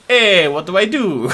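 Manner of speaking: loud, high hammed-up Italian accent. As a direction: put on a silly, over-the-top Italian accent. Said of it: "That is my only Italian accent".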